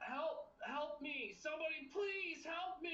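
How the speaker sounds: high, pleading calls for help; fluttering cries for help. Pretend. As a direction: cry out for help like a victim. Skinwalker mimicking victim's cries.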